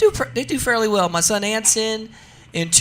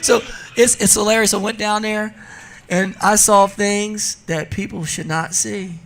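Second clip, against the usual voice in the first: ordinary talk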